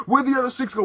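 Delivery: high mock cop demand; retold cop grilling scared kids. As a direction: question them like a pushy cop. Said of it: "And then he's like".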